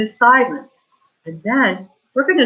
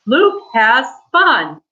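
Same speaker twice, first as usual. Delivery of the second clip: louder, slow excited reading; 4 semitones higher, 4 dB louder, slower than usual. Shown as a demonstration. Teacher models exclamation for class, excited. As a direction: sound thrilled about the fun. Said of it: "So we say it excited".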